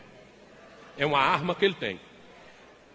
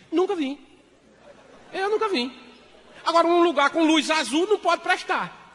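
much higher, loud protest; high indignant denial; 10 semitones higher, 4 dB louder than usual. Acted. Husband denying knowing the cabaret, high protest. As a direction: protest too much, squeaky.

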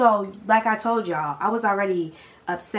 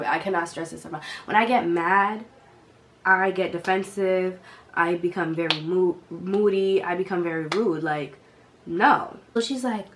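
ordinary talk

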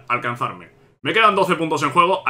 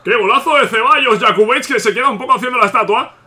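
higher and louder exclamation; breakneck goal commentary; 6 semitones higher, 4 dB louder than usual. Real, not acted. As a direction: react to the goal in a rush. Goal scored against. (comentario frenético de gol)

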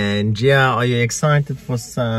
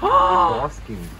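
squeaky high. Real, not acted. Excited at big snake.

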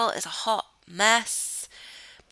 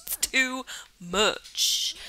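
TOO MUCH stretched out very slowly, higher; drawn-out exasperation; 4 semitones higher, much slower than usual. Real, not acted. Gamer complaining of the cold to viewers, drawn-out exasperation. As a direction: drag out "too" and "much" in mock despair. Mock despair about the cold.

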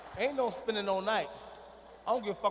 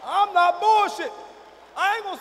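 angry warning; 9 semitones higher, 11 dB louder than usual. Acted. Father warning the crowd he is serious, angry shout.

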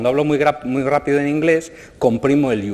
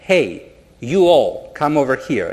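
very slow, louder American English call; 4 dB louder, much slower than usual. Shown as a demonstration. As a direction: drawl it out, calling a group over in American English. (llamada en inglés americano, lenta y alargada)

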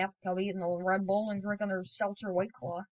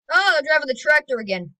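louder, higher excited call; excited spotting; 6 semitones higher, 10 dB louder than usual. Real, not acted. Spotting the tractor driver in game, excited.

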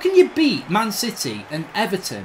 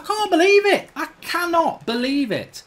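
exasperated outburst; 8 semitones higher than usual. Real, not acted. Player reacting to another Burnley goal, exasperated outburst. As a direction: throw it up in exasperation.

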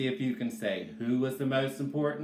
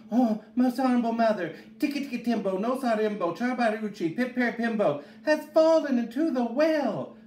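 put-on high-pitched, hurried child raising the alarm; high urgent little-boy voice; urgent child's cry; 9 semitones higher, 4 dB louder, faster than usual. A narrator's reading. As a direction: rattle off the alarm to mother, high and breathless with urgency. Child urgently calls mother for help.

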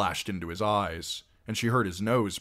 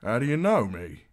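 wary, louder questioning; suspicious; 5 semitones higher, 6 dB louder than usual. A narrator's reading. Outlaw questioning the strange girl, suspicious. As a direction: demand it with suspicion. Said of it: "frowning suspiciously".

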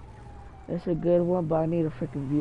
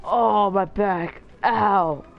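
loud, drawn-out mock ow; mock pain cry; 3 semitones higher, 7 dB louder, much slower than usual. Player whose character got hit, mock cry of pain. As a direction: cry out as if hurt, hammed-up.

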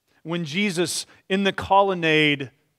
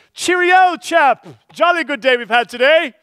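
hammed-up posh British accent, higher and louder; 9 semitones higher, 8 dB louder than usual. Stranger greets churchgoers after church, mock British accent. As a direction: play a jolly upper-class Englishman in a British accent. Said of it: "The Galileans had a distinctive accent".

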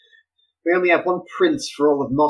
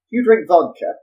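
usual speaking voice

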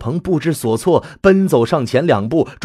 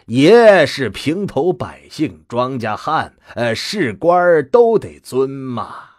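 loud, higher mock deference, slow; deliberately loud; 4 semitones higher, 4 dB louder, slower than usual. Grandfather mocking his grandson's title, loud and sarcastic. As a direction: play humble loudly so all hear. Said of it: "故意放大音量".

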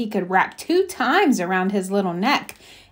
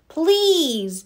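high, pleading please; begging rabbit; 7 semitones higher than usual. Acted. Rabbit begs the squirrel to let him in, pleading. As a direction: plead in a higher pitch. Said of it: "begged the rabbit".

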